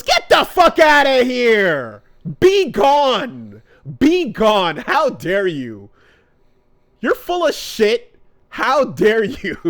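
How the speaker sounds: mock rage, a laugh at the end; furious outburst. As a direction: throw her out, outraged and disgusted. Naruto voiced, furious rejection.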